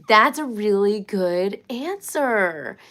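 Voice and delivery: loud, slow, delighted; excited praise. Real, not acted. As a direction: really cheer the answer on. Praising a viewer's comment.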